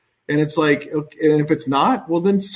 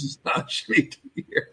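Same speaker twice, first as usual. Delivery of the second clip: amused, breaking into laughter as you insist; laughing; 4 semitones higher, 7 dB quieter than usual. Real, not acted. Co-host relaying an adamant chatter's objection, laughing. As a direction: talk through a real laugh. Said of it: "I'm laughing because".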